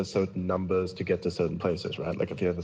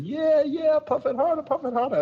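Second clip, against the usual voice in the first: high, loud mock cheering; imitated eager egging-on; 6 dB louder than usual. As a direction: egg him on, totally into it. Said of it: "he got so into it".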